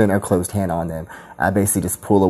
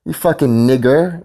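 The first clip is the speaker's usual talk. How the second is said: heated; 8 semitones higher than usual. Real, not acted. Heated rant.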